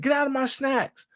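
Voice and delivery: high-pitched playful telling-off; mock scolding. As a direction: act out guarding your snacks. Retold squabble over snacks.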